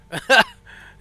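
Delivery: loud, high laugh; burst of laughter. Real, not acted. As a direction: laugh out loud at an awful thought. Laughing at the chafing talk.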